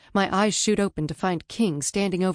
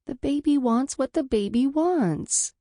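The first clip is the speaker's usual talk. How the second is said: higher, slow, sulky pout; childish pout; 8 semitones higher than usual. Acted. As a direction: whine like a pouting child. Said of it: "bottom lip stuck out like the child".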